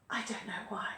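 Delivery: a quick breathy whispered aside; conspiratorial whisper to the class. Real, not acted. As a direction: drop to a whisper, as if to yourself.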